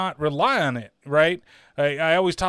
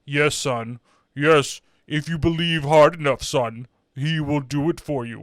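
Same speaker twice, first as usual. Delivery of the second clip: put-on solemn father voice; earnest dad; 3 semitones lower than usual. Father assuring his son he will walk, in a mock-solemn dad voice. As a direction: play a hammy, reassuring movie dad. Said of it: "his parents are bringing him".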